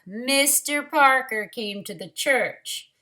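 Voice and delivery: plain reading voice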